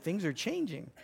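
soft, backing-off dog voice; dog's mock alarm. Preacher voices the dog he charged, mock alarm. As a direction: play a dog having second thoughts, softly. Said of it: "You see the dog going".

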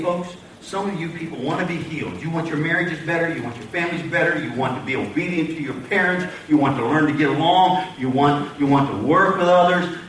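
ordinary talk, nothing marked

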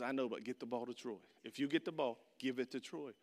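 a low hushed huddle instruction; coach's orders. Retold or imitated. Coach in the final huddle, low and hushed. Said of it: "He goes".

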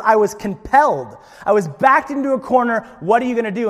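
loud, high, pleading; whiny excuse. Acted. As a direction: sound hard done by. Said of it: "excuse making".

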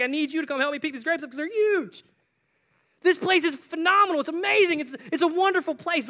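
loud, high-pitched gushing; amazed spy. Acted. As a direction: gush at full volume, thrilled by the find. Acting out amazed spies seeing the land.

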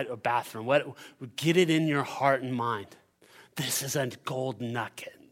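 ordinary delivery

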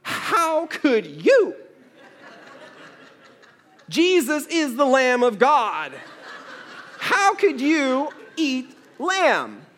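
high, slow, laughing mock horror; laughing mock indignation, pitched high; mock outrage. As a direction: play up the scandalised shock with a laugh. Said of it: "And I thought".

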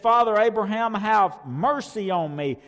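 a rich man in torment begs Abraham, pleading loudly; pleading cry. Acted. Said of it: "He said".

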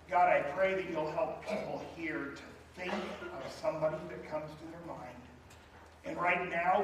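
earnest altar-call prayer voice; pastoral prayer. Real, not acted. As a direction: pray over the bowed congregation. Leads a prayer.

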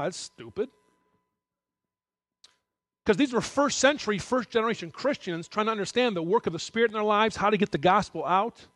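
ordinary talk

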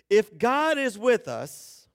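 loud, slow and aggrieved; bitter complaint. Retold or imitated. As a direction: demand it like a wronged man.

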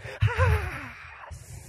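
long high groaning sigh; heavy sigh. Retold or imitated. Tired kid facing homework, long weary sigh.

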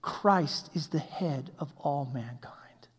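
slow, hushed whisper for emphasis. Real, not acted. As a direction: breathe it out slowly, almost silent. Preacher drops to hushed emphasis.

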